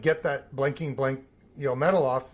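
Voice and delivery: ordinary talk, nothing marked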